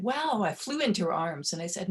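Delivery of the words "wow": higher, louder retold "wow"; amazed relief. Daughter hearing her mother's apology, retold with amazement. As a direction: let the surprise lift your voice.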